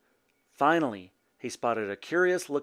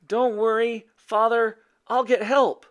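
put-on high, raised boyish call; 10 semitones higher, 5 dB louder than usual. A narrator's reading. Boy running off for help, calling to his father. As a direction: call it back to someone behind you. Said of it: "called over his shoulder".

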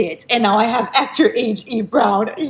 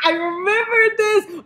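excited; 11 semitones higher, slower than usual. Real, not acted. Recognizing a wished-for book.

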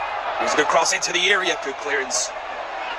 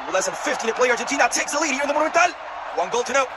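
goal call; 10 semitones higher than usual. Real, not acted. Goal called live.

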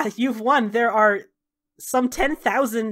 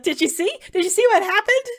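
much higher, louder tearful wonder; overwhelmed excitement; 9 semitones higher, 4 dB louder, faster than usual. Acted. Salvador asks his friends if they saw the miracle, tearful and high. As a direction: gush it out, barely believing.

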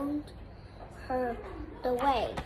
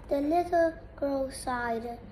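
usual speaking voice

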